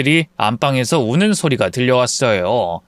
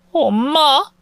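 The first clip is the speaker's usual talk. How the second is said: put-on small child; 10 semitones higher, much slower than usual. Child voice calling mother.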